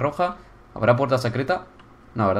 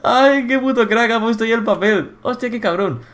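amused amazement; 7 semitones higher than usual. Real, not acted. Player admiring the builder's toilet paper, high and delighted. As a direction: marvel at it in a raised voice.

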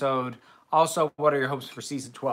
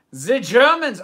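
higher, much louder title call-out; excited exclamation; 7 semitones higher, 10 dB louder, slower than usual. Acted. Host repeats the Fawlty Towers episode title, excited exclamation. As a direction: burst out with the title, loud and raised.

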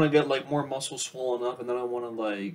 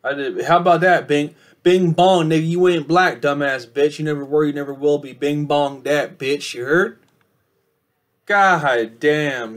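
loud mocking jeers, higher; taunting rant; 4 semitones higher, 10 dB louder, slower than usual. Vlogger taunts rival Nems directly, loud mocking rant. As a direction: jeer at the rival like he's right there.

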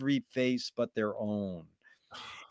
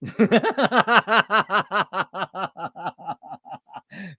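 long, real burst of laughter; 4 semitones higher, 3 dB louder than usual. Real, not acted. Listener cracking up at the joke's punchline, long laugh.

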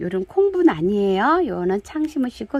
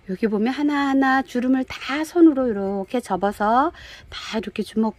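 ordinary talk, nothing marked

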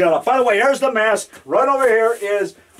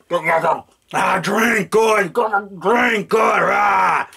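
Frankenstein monster; 2 semitones lower, much slower than usual. Acted.

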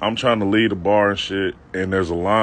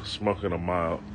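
ordinary talk, nothing marked

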